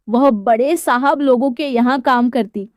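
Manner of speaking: usual speaking voice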